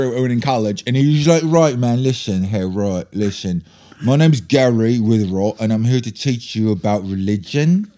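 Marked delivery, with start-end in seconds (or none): 1.4-7.9 put-on British accent impersonation. Guest speaker addressing a college class, imitated British accent. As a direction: imitate a British guest teacher introducing himself. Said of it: "my British accent is an impersonation of a guy named [name]".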